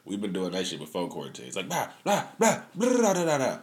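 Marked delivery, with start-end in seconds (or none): 1.7-3.6 mock bickering blah. Mocking the back-and-forth bickering.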